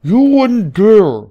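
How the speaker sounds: very high, loud, slow drawn-out protest; outraged cry. A narrator's reading. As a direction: shriek it in mock horror. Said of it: "cried Sparkler".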